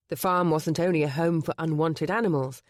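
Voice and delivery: usual speaking voice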